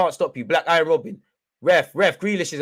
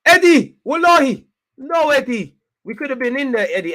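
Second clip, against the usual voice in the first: loud, high cry of disbelief at a miss; anguished shout; 10 semitones higher, 9 dB louder than usual. Real, not acted. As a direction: scream at the striker who just blew it. Fan yelling at a missed chance.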